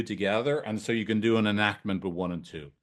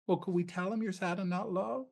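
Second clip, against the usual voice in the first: high, much softer, tender question; gentle therapist; 8 semitones higher, 9 dB quieter than usual. Shown as a demonstration. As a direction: coax the client softly, lifting the question. Therapist voiced in role-play.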